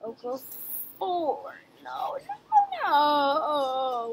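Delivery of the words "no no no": real anguish; anguished wail. Real, not acted. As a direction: cry it out as the lead slips. Losing the game.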